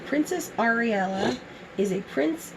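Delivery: ordinary delivery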